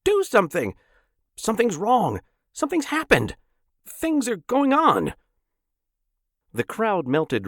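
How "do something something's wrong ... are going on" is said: high, loud, desperate cries; frantic screaming. A narrator's reading. Lois screaming at the crowd in panic, high and loud. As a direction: beg them to do something.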